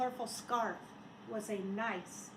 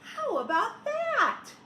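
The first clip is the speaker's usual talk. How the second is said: put-on high, delighted kids' cry; high delighted children; children's exclamation; 9 semitones higher, 6 dB louder, slower than usual. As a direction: cheer it like a crowd of kids. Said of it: "The children all said".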